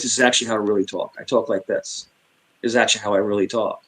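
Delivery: fast, offhand New York talk; quick, low-key native patter; real voice. Shown as a demonstration. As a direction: drop the nice voice and rattle it off. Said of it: "if you wanna hear my real voice".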